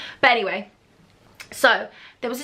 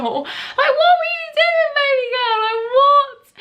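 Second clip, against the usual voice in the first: high, loud mock outrage; teasing her younger self, high shrieking disbelief; 4 dB louder, slower than usual. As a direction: shriek it in mock horror at yourself. Mocking her past self, shrieking.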